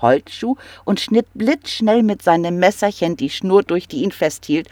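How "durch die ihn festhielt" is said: plain reading voice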